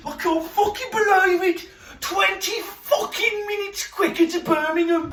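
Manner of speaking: high-pitched, shouted mock outrage; mock hysterical rant. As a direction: squeal it out in fake hysteria.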